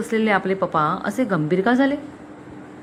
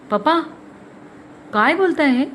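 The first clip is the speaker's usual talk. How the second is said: higher, shocked outcry; nearly shouting; 7 semitones higher than usual. A narrator's reading. Daughter reels at father's accusation, nearly shouting. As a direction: protest in a restrained cry. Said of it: "ती जवळजवळ ओरडलीच".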